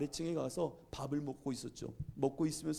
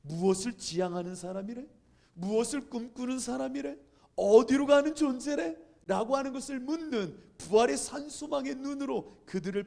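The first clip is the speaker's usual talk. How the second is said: raised, ringing sermon delivery; emphatic preaching; 8 semitones higher, 7 dB louder than usual. Real, not acted. Preacher urging the congregation toward hope, emphatic. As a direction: preach in insistent rhetorical questions.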